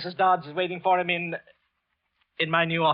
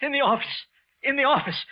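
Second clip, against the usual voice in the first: high, frightened gasping words; terrified stammer; 10 semitones higher than usual. Acted. Wilson telling coworkers what he saw, terrified. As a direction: push the words out in terror.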